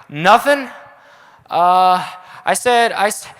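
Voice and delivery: high, loud, panicky stammer; hammed-up awkward cover-up; mock flustered denial. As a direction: act caught out and fumbling for an answer. Acting caught out before a crush.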